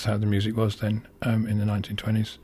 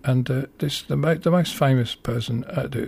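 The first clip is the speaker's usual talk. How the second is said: ordinary talk, nothing marked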